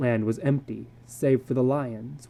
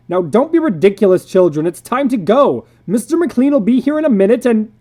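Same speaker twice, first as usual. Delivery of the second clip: high, loud urgent plea; urgent pleading; 7 semitones higher, 10 dB louder than usual. Acted. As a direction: plead through the door. Parents pleading at door.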